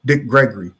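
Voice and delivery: ordinary talk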